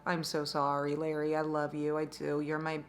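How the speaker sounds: soft, low loving apology; love bombing. Real, not acted. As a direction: gush sweetly to your bestie.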